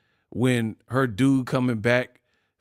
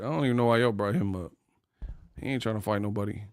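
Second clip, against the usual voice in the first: ordinary delivery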